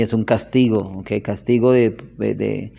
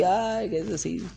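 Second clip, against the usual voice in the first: mock tearful; 5 semitones higher, 9 dB quieter than usual. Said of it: "Y se puso a llorar".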